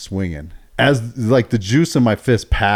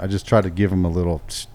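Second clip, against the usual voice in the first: ordinary delivery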